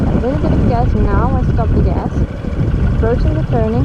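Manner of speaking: muffled voice in a helmet; talking inside a helmet on the bike; inside a motorcycle helmet. Real, not acted. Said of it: "You can hear me in, in the helmet".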